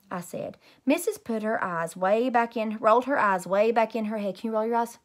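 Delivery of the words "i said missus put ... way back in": usual speaking voice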